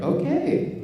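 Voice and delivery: very high, squeaky-pitched okay; exaggerated high-pitched awkward assent; mock uneasy agreement. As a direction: go way up high, comically taken aback. Awkward reply to marriage-hungry classmates.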